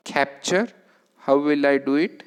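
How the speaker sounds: usual speaking voice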